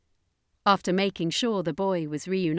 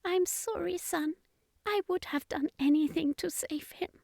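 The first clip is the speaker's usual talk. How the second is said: high, soft, sorrowful mother; grieving mother; 11 semitones higher, 5 dB quieter than usual. A narrator's reading. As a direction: comfort your son through grief. Mother consoles grieving son.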